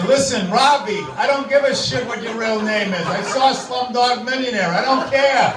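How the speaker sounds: high, insistent mock rant; hammed-up irate customer, pitch climbing; exasperated. Fed-up customer challenging the call-center worker, exasperated. Acted angry customer on phone.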